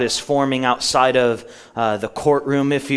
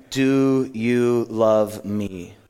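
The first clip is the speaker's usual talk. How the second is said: each word drawn out and separate; deliberate, solemn scripture question; slow, weighty question; much slower than usual. Acted. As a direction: ask it very slowly, weighing every word. Slow reading of Jesus' third question.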